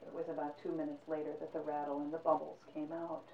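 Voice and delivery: softly told grim death; hushed narration. A narrator's reading.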